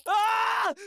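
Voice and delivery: sudden frightened yelp; startled scream. Acted. Coward who just saw something, startled scream.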